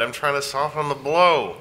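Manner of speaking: ordinary delivery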